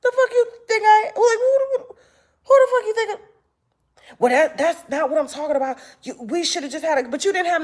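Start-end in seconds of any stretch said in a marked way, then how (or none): 0.0-3.2 higher, incredulous and fast; a sharp who-do-you-think-I-am snap; high indignant outburst. Retold or imitated. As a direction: fire back in mock outrage at him. Outraged retort to critic.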